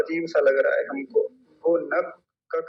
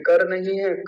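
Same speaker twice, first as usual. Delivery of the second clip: ordinary talk, nothing marked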